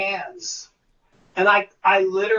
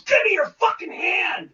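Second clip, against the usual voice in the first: higher, angry demand; angry director; 7 semitones higher than usual. Retold or imitated. Director storming onstage at the actor, angry shout. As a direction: bark it as you storm up. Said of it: "He goes".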